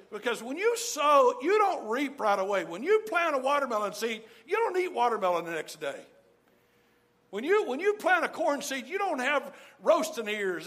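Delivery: high, loud, driving preacher voice; preaching at full force. Real, not acted. Preacher pressing the congregation on patience, in a high, loud voice. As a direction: ring it out to the whole room.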